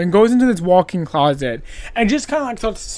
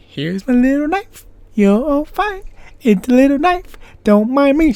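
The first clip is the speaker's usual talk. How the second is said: higher, teasing sing-song killer voice; creepy playful killer; 5 semitones higher, 4 dB louder than usual. Retold or imitated. Killer teasing his victim, mock sweet creepy taunt. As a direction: taunt him sweetly with your little knife.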